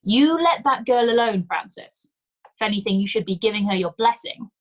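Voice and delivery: loud, stern telling-off; stern rebuke, pitch and volume up. A narrator's reading. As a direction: scold him like a bossy old woman. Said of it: "gestured sternly".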